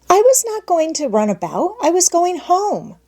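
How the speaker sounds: put-on high, pleading little girl's voice; a scared child protesting she only wants home; frightened child. A narrator's reading. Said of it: "said Heidi, frightened".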